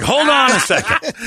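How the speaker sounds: high, slow, laughing indignation; indignant disbelief. Retold or imitated. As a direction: stop him mid-sentence, half laughing. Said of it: "And I'm like".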